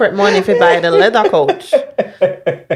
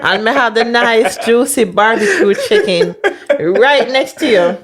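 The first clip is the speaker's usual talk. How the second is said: slowed, tempting tease; slow teasing; 2 semitones higher, slower than usual. Acted. Wife tempting him with barbecue chicken, slow tease. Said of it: "teasing him with food".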